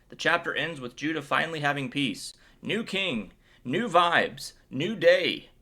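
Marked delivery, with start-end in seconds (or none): 2.6-5.5 higher, building in volume; celebratory. Real, not acted. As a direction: hype up each short phrase. Celebrating the peaceful new reign.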